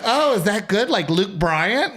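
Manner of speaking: high, eager questioning voice, imitated; naive woman. Woman asking if he's like a star, high imitation. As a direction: pitch it up and stretch the singer's name.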